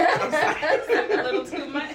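high, loud, stretched-out laughing remark. Real, not acted. Panelist teasing a friend mid-answer, laughing. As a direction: say it slowly through real laughter.

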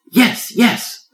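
high, loud eager yes. A narrator's reading. Turtle answering lion he's ready, eager. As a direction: bounce with eagerness. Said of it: "the tiny turtle eagerly replied".

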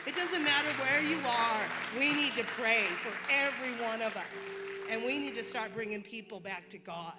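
rally the whole room to pray; preaching exhortation. Acted. Woman urging the church to pray for everyone, preaching loud.

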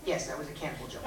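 normal talking voice

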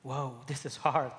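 ordinary talk

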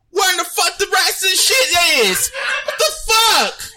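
outraged shout. Real, not acted. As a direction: shout it out in disbelief. Outraged at missing tripod pieces.

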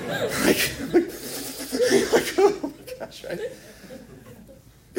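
high, squeaky mock sobbing through laughter; very high, breaking into laughter; mock crying. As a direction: squeal it like a laughing, hammed-up weeper. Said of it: "I start crying".

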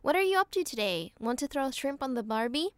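deliberate American-accented line reading. Shown as a demonstration. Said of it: "do one more time?".

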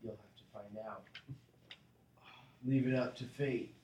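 usual speaking voice

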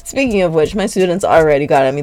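ordinary delivery

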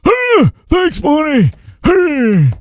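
put-on high, creaky old geezer voice; old man voice. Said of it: "Monty's laughing at my old man voice".

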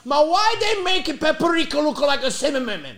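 high, loud, baffled dad blaming the company. Retold or imitated. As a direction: blame the seasoning company out loud. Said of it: "He goes".

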